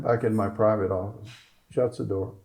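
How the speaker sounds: usual speaking voice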